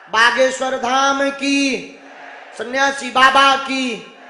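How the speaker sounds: loud, drawn-out slogan call; rally speech. Acted. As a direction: stretch each call for the crowd. Leads crowd chant slogans.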